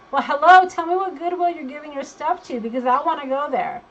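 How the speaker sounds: excited. Real, not acted. Excited about Goodwill purge.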